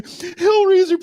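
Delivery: high, loud call of the name; pleading call. Pretend. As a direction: beg him to stop. Begging call to Hellraiser.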